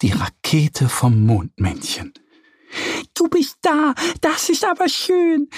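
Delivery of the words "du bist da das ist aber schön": much higher, bright-voiced child cheering; high jubilant little girl voice. A narrator's reading. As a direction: squeal it out with joy. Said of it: "jubelt Annie".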